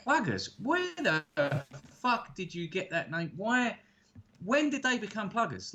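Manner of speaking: high-pitched, incredulous questioning; exaggerated bewilderment at an odd word; mock disbelief. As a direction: retell being baffled, voice jumping right up.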